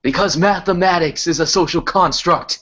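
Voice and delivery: lightly voiced old-time TV announcer; a touch of 1950s newsreel delivery; Bernie Sanders 1950s TV voice. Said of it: "that nineteen fifties ass, uh, T V voice".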